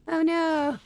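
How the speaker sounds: ordinary talk, nothing marked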